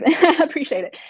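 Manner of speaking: laughing as you thank them; a bright laugh into the thanks. Real, not acted. Laughing reply to a compliment.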